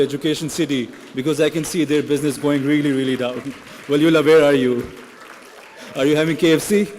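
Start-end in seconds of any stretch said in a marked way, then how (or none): 3.9-6.9 very loud, raised call across the hall. Real, not acted. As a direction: shout teasingly to someone in the audience. Calling out friend in audience.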